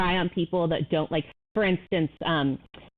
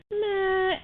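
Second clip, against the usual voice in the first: high, flat shrug of a sound; unbothered toddler; 14 semitones higher than usual. Retold or imitated. Voicing the calm daughter shrugging off hurt, high meh. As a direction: toss it off like a calm kid. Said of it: "she's like".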